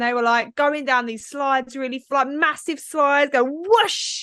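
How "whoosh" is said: rising, high whoosh noise; drawn-out playful slide whoosh; imitated whoosh. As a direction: swoosh down a big slide. Imitates shooting down water slide.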